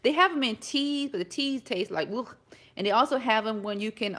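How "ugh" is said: short throaty "ugh" of disgust. Shown as a demonstration. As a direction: recoil from a nasty taste. Mimics reaction to tea's taste.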